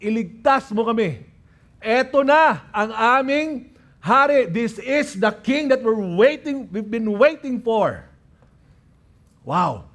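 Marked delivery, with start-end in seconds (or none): 0.0-8.0 raised, fervent cry; high-pitched, impassioned acclamation; crowd's declaration. Acted. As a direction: proclaim it as a weary crowd finding its king. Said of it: "and they were saying".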